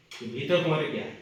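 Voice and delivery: ordinary delivery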